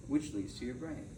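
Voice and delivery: usual speaking voice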